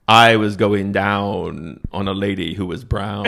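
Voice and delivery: slow, solemn mock poetry reading; mock poem recital. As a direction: recite it like a grave poet, slowly. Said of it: "it's a powerful poem".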